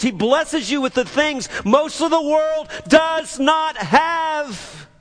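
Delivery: high, building sermon climax; impassioned preaching. Real, not acted. Preacher listing what God gives, impassioned preaching. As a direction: build steadily to a ringing peak.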